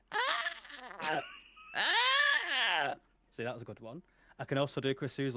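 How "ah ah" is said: high, loud screeching cry; Artist's cry; Artist's bird cry. Retold or imitated. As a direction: screech out like calling the birds. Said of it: "Artist when she's, when she's doing the birds".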